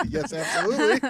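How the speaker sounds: laughing while answering yes. Real, not acted. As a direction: laugh through the words. Laughing at the idea.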